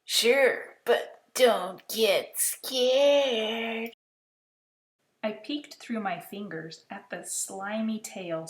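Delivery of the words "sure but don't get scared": slow monster warning. Acted. Monster reassuring boy.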